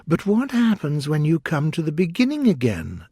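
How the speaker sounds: a touch of eager curiosity. A narrator's reading. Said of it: "Alice asked eagerly".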